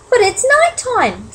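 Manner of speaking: high, slow little squeak; small high-pitched protest. Acted. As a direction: squeak it out in worry. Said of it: "Stella Luna squeaked".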